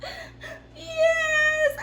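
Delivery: very high, hammed-up crying wail; mock boohooing. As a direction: squeal it through fake sobs. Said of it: "I just was boohooing".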